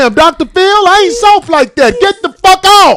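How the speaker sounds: high, loud mock yell at the auntie. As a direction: bark it at her, high and loud, half-joking. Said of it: "I be like".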